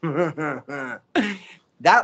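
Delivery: slow, laughing cartoon-character voice; character's goofy laugh. Shown as a demonstration. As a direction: laugh it out like a dopey commercial guy. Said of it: "this character had a laugh that goes like this".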